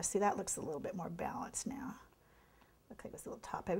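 hushed whisper to herself while painting; whispered aside. Real, not acted. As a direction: drop to a near-whisper, just for yourself.

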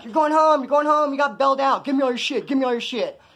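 high, loud excited hollering from a crowd of inmates; excited yelling. Retold or imitated. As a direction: shout it like the whole barracks is cheering you off. Inmates yelling at one bailed out.

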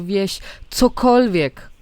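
ordinary delivery, nothing marked

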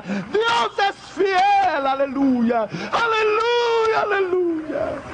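high, slow, tearful shouts of praise; fervent crying out. Real, not acted. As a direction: cry out to God at the peak. Preacher's climax, crying out. (clamor ferviente con llanto)

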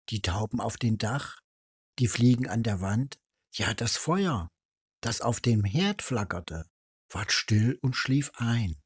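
usual speaking voice

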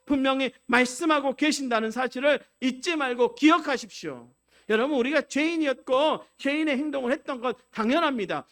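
loud, high, fervent preaching; impassioned sermon climax to the congregation; preaching at full force. Acted. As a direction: drive the blessing home with full conviction.

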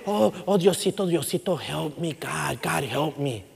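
panicked prayer, falling quiet; desperate plea. Retold or imitated. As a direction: beg God under your breath.

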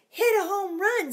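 high loud ballpark cheer; high shouted cheer. Acted. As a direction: cheer from the stands. Cheering at ball game.

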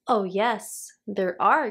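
plain reading voice

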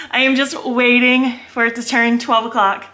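ordinary talk

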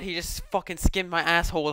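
ordinary talk

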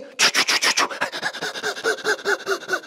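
breathy rapid "tchu tchu" swim noise; imitated splashing. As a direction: mimic fast strokes with your mouth. Swimmers splashing fast past.

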